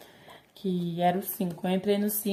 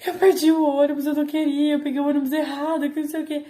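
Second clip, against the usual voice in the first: higher, louder imitation of crying panic; mock tearful wail; 6 semitones higher, 4 dB louder than usual. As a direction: whine it out like you're about to cry. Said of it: "eu já quis chorar". (imitando choro, voz aguda e desesperada)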